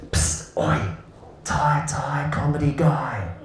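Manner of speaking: slow, hissed stage whisper. Retold or imitated. A student calling across drama class, slow stage whisper. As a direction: whisper it so the whole room hears. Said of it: "do this big stage whisper".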